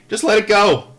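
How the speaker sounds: punched high and loud; emphatic advice. Real, not acted. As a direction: let it go, said forcefully. Advice to the boys.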